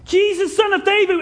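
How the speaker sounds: high, loud, urgent call; shouting. Acted. Blind beggar trying to make Jesus hear him, shouting. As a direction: shout the name to catch a passer-by. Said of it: "he's shouting".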